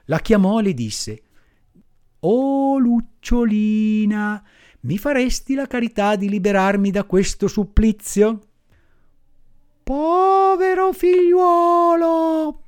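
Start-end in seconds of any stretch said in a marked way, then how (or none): 2.2-8.4 lightly voiced puppet boy, a touch higher, begging; pleading little puppet. Pinocchio begs the firefly. 9.8-12.6 high, slow, tender firefly voice; pitying firefly. Acted. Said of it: "fermandosi impietosita".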